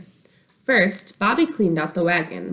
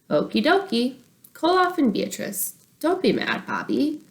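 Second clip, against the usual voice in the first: little sister voice; 6 semitones higher than usual. Acted. Casey's voice.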